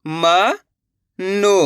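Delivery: each syllable sounded separately, pauses between; letter by letter. Shown as a demonstration. Teacher sounds out a word for beginners, letter by letter. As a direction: break the word into its letters, slowly. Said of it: "एक ही अक्षर का उच्चारण".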